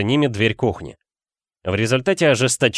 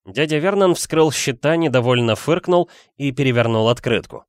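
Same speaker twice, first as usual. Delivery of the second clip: ordinary delivery, nothing marked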